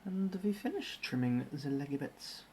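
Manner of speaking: narrating each step in a hammed-up German accent; mock German accent with z for th; bad German accent. Streamer narrating his Lego build in a mock German accent. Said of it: "In a German accent, apparently".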